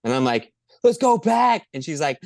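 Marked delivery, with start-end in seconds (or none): 0.8-1.7 rally the home team with a loud cheer; cheering shout. Retold or imitated. Cheering at rival fan.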